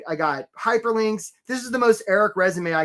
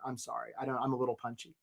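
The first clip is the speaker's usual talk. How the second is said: normal talking voice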